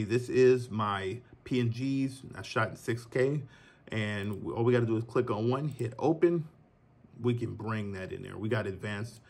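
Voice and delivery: normal talking voice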